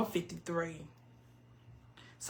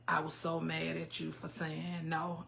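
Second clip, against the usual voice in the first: low, much softer read-out; quiet comment reading; 4 semitones lower, 10 dB quieter than usual. Real, not acted. Streamer reading a viewer's comment, low and quiet.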